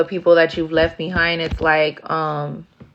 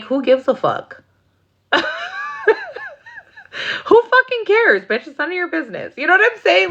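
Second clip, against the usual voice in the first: much higher, sassy mock voice; sassy dismissal. Dismissing the nosy ex, high and sassy. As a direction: brush off the nosy question with sass.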